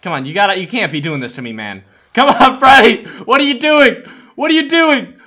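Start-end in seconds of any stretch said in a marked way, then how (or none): 2.1-5.1 high, loud, incredulous yelling; exasperated shout. Real, not acted. Player to Freddy who is botching it, exasperated shout. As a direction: shout in disbelief at your teammate.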